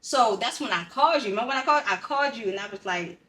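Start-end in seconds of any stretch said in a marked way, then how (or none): none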